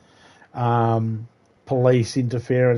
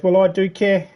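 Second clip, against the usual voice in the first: lightly mimicked neighbour, higher pitched; neighbour objecting; 7 semitones higher than usual. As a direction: imitate a put-out neighbour. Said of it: "And he goes".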